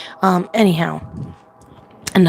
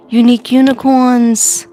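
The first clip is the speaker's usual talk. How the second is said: Unique unicorns called out louder and higher; cheery greeting call; 5 semitones higher, 5 dB louder than usual. Shown as a demonstration. Greeting her viewers, Unique unicorns called out loudly. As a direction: call out to the fans, bright and loud. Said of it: "I forgot to say".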